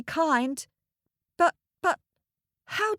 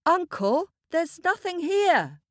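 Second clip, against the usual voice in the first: calling out, higher pitched; mild shout; 8 semitones higher than usual. A narrator's reading. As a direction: call out from inside the cave. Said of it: "he shouted".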